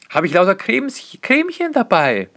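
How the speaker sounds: cutesy high. Real, not acted. Cremchen niedlich betont.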